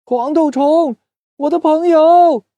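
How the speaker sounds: high, loud, anguished cry; desperate cry. Acted. Doudou crying out for the bug left behind, high and desperate. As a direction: call out for a lost friend.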